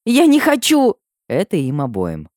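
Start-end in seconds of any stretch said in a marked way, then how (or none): 0.0-1.0 high, loud cry of refusal; shouting. A narrator's reading. Heroine refusing before both dragons, shouting. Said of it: "кричу какому-то Дориану".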